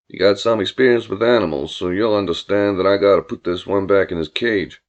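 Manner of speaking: low-pitched, folksy country man; easygoing drawling Billy. Acted. Billy greeting Julia about his cousin, folksy low voice. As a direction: lay on the low, courteous charm. Billy's dialogue, story character.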